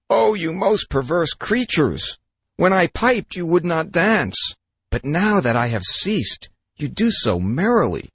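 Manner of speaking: high, slow, hammed-up exasperation; exasperated scolding. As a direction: cry out at the perverse fish. Fisherman scolds leaping fish.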